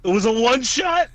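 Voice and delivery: loud shocked question; surprised. Real, not acted. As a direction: blurt it out in disbelief.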